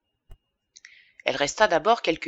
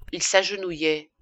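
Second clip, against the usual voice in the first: plain reading voice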